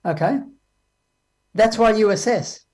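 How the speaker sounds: normal talking voice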